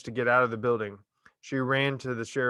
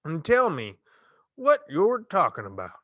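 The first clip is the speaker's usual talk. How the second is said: slow drawl; 6 semitones higher, 3 dB louder than usual. Acted. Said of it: "in a slow drawl".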